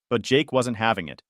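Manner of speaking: ordinary delivery, nothing marked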